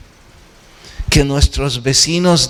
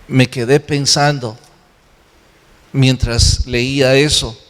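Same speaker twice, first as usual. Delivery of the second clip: ordinary talk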